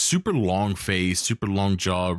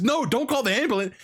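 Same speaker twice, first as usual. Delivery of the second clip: much higher desperate no; panicked plea; 14 semitones higher than usual. Retold or imitated. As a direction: stop her from calling. Lola begs Maxine.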